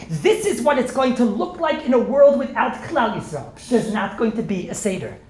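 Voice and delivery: a lightly voiced Mordechai pleading his case; Mordechai warning God. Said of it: "sagt Mordechai".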